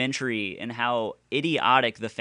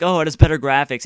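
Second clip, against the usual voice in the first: mock fan; 5 semitones higher, 9 dB louder, faster than usual. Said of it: "And they're like".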